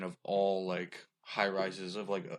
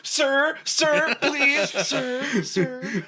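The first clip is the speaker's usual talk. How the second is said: high, loud pleading reporter; 10 dB louder, much slower than usual. Retold or imitated. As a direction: beg desperately for attention. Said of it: "he's going".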